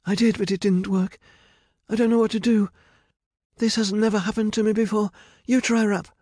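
high, loud helpless panic; panicking. A narrator's reading. Medea's magic has failed, panicking. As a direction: blurt it out at your wits' end.

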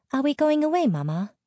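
put-on high-pitched little girl's question; little child. A narrator's reading. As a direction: ask it high, like a small child. Small child asks her mother.

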